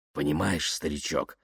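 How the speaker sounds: softly, familiar and confiding; confidential. Acted. Yuklin taking Kaftanov aside as old pal, confidential.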